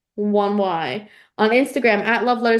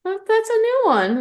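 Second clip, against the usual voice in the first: high-pitched mock disbelief; mock surprise; 9 semitones higher than usual. Real, not acted. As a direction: squeak it out in surprise. Repeats reaction, much higher.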